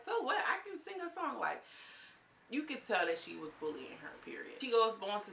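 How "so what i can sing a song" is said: mock taunt. Quoting Barbie mockingly.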